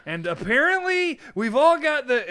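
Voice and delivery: high, loud "apparently"; kid interviewee. Retold or imitated. Imitating a kid interviewed for the news, loud high voice. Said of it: "he can't stop saying "apparently".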